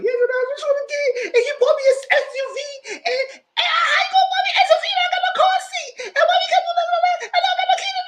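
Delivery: very high squealed, wailing mock sob; hammed-up crying fit. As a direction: imitate her bawling in a loud, high whine. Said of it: "She was all that crying".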